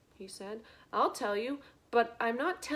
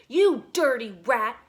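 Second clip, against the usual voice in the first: much louder furious insult; angry shout; 13 dB louder than usual. Acted. Prisoner shouting an insult at the thieving rat. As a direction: spit the insult out. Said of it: "shouted the prisoner".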